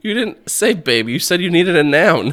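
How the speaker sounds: high, loud defensive retort; heated protest. Real, not acted. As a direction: argue back, voice pitched right up. Arguing back over the game.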